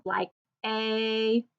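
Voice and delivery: "A" said as a clear long ay; long A sound. Shown as a demonstration. Teacher modelling long "A" for the class. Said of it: "the long A sound".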